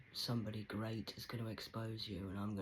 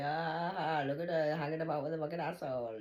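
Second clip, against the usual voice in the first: loud, higher-pitched mimicking of a sneer; mocking onlooker; 5 semitones higher, 7 dB louder than usual. Viewers judging her company yesterday, mimicked mockingly. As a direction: imitate a judgemental onlooker, loudly.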